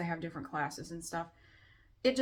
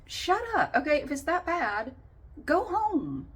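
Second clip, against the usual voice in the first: higher, louder imitated exasperation; mock snapping; 11 semitones higher, 5 dB louder, slower than usual. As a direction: fire it off like a fed-up gym-goer. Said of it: "trying to work out and go".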